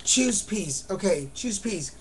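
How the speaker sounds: ordinary delivery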